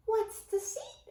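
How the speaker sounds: small mouse wondering; curious. A narrator's reading. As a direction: ask wide-eyed. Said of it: "asked Ced curiously".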